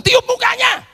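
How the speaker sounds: high, loud shout to be heard; shouted repeat. Retold or imitated. Preacher repeating to a husband who cannot hear, shouting. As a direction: shout it again over the crying.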